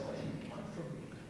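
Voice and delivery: hushed, nearly voiceless plea; emotional. Retold or imitated. Man pleading with his horse, emotional and hushed. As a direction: drop to a defeated whisper, as if giving in. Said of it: "got emotional".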